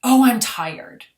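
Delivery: drawn-out tired sigh, high and loud; mock sleepy sigh. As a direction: act out the weary excuse. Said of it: "yawning is like".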